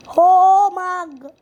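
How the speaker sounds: loud, slow, stretched-out call; excited announcement. Real, not acted. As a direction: drag it out like an announcer. Announcing the role in game.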